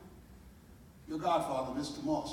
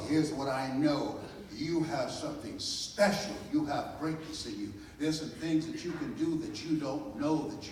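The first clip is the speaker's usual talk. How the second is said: loud, rising preacher's cadence; preaching; 3 semitones higher, 9 dB louder than usual. Real, not acted. Speaker preaching to the audience at full force. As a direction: build them up, hammering each line.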